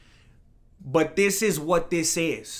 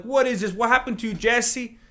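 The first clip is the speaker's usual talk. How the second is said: puzzled fan; 6 semitones higher, 3 dB louder than usual. Retold or imitated. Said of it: "scratching their heads like".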